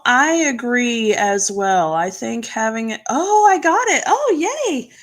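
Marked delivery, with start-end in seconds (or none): 3.1-4.9 high, swooping happy exclamation; delighted relief. Real, not acted. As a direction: cheer at getting it right, pitch leaping up. Learns she pronounced the name right.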